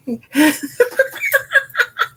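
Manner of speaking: high laugh with delight; giggling delighted; bright laugh. Real, not acted. Laughs after compliment.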